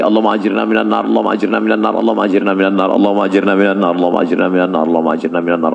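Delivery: fast, low murmured repeated prayer; rapid prayer. Acted. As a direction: rattle off the prayer quickly.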